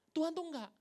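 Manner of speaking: ordinary talk, nothing marked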